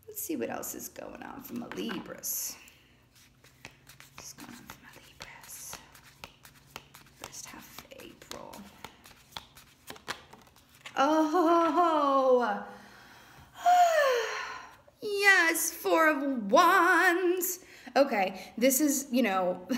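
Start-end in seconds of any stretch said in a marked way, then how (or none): 0.1-8.6 hushed whisper to yourself; barely audible, breath-only murmur. Real, not acted. Reader asks the cards about the Libras, whispering. 11.0-17.6 high, drawn-out cry of delight. Real, not acted. Reader turns up a lucky card, delighted.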